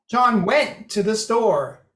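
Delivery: went leaned on and drawn out, slowly; stress on WENT. Shown as a demonstration. Lecturer emphasizing that he did leave, stress on went. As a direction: hit the word went. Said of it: "you're emphasizing how he got there".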